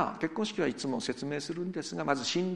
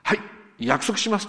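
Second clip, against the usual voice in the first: loud vow; 5 semitones higher, 6 dB louder than usual. Retold or imitated. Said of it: "と大きな声で言った".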